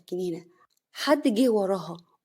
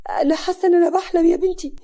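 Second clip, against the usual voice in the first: stunned, rising panic; 8 semitones higher, 8 dB louder than usual. A narrator's reading. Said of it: "سعاد بصدمة".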